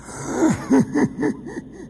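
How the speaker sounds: high, whispery sinister chuckle; eerie chuckle. Acted. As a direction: chuckle like a madman.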